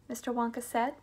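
ordinary delivery, nothing marked

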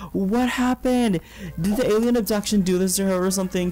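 high startled disbelief; sudden astonished outburst; surprised. Real, not acted. Sudden shock at muscular Sim.

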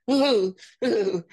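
high, loud fluttery "ooh, ooh"; hammed-up fairy squeal; mock Winters coo. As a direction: coo like a startled woodland sprite. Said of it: "he goes".

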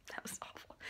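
breathy whisper, barely voiced. Real, not acted. Wincing after laughing hurts her nose, whispered.

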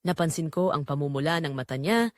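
ordinary delivery, nothing marked

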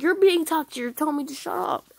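high, loud retort; mock indignant protest; indignant comeback. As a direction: throw the accusation back, acted. Imagined argument with toxic player.

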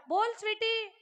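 mock a roused sleeper calling back; hint of woken tiger. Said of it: "असा वाघ झोपेतून उठावा".

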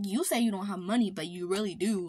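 ordinary talk, nothing marked

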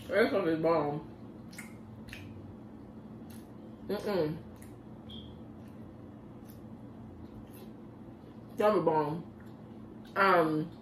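chewing while talking; mouth full. Real, not acted. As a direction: talk through a bite. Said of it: "I'ma take a bite".

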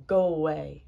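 loud storybook dismissal; dismissive. A narrator's reading. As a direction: send the visitor off forcefully. Toad sends Frog away.

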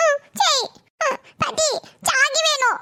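put-on very high toddler voice; little child. Grandson begging grandmother for chocolate, little child's voice. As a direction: plead like a small child in a tiny high voice.

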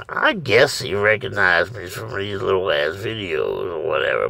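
ordinary delivery

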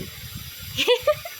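high unvoiced giggle; soft breathless chuckle; breathy laugh. Real, not acted. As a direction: let out an airy laugh at the bird. Laughing at the sulking bird.

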